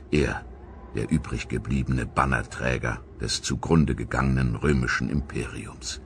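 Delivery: ernst erzählt, gegen Ende langsam und getragen; dramatische Erzählung. Acted. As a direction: wie ein letzter Römer vor der Schlacht. Dramatic inner monologue of Syagrius. (feierlich-dramatischer Erzählton)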